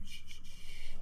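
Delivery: gentle whispered shushing; soothing shush. Real, not acted. Soothing a crying partner.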